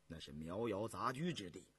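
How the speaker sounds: low, soft counsel; cautioning character. Acted. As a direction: voice a grave warning, kept low and quiet. Voiced character in story narration.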